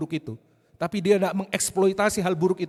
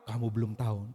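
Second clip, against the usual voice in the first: sarcastic; 6 semitones lower, 4 dB quieter than usual. Pretend. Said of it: "itu sarkastik".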